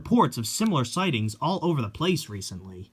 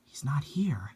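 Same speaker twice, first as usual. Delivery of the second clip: softer, uneasy whisper; nervous whisper; 5 dB quieter than usual. Acted. Girl waiting alone at the temple, nervous whisper.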